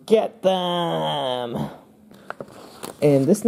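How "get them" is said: drawn-out, held-back battle cry; mock shout. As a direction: call it out long without real volume. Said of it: "this guy's shouting".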